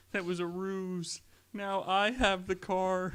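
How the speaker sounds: high, slow, put-on cartoon voice; high drawn-out comic voice; quoted cartoon voice. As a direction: squeak it out slow like a goofy cartoon character. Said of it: "God, I love that shit".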